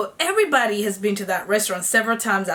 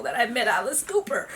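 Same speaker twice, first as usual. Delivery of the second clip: ordinary delivery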